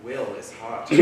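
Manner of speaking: usual speaking voice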